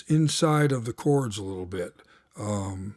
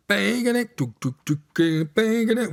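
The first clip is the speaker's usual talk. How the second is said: voiced horn hits, pitched higher; horn stabs; 7 semitones higher than usual. Shown as a demonstration. Teacher imitates the horn stabs he hears in the bridge, higher. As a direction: bang it out like a horn section. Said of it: "what I'm hearing is like the horns".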